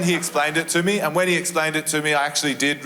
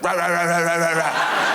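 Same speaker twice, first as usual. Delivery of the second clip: barking like a dog. Retold or imitated. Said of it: "that guy on A Current Affair was like".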